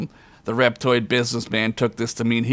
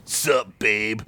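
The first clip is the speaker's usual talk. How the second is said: sleazy reptoid voice. Acted.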